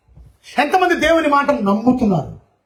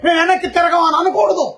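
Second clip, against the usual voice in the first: defiant shout; 7 semitones higher than usual. Acted. Preacher voicing the defiant people, shouting. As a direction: shout it back like a stubborn crowd.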